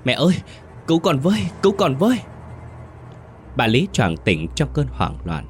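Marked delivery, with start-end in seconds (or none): 0.0-2.2 high-pitched, panicked plea; terrified cry for help. A narrator's reading. Daughter choking herself wakes calling mother, terrified cry. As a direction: cry out for rescue, voice pitched far up. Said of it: "siết chặt miệng kêu thét".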